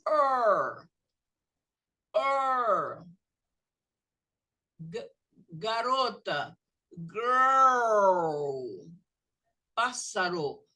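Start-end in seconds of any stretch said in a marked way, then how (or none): 0.1-3.0 long English er, held and loud; er sound. Shown as a demonstration. Teacher drilling the class on er, held out loud. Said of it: "E o som é". 5.5-8.9 put-on girl with a long, loud English er. Shown as a demonstration. Teacher modelling girl for the students, loud. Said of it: "E o som é er".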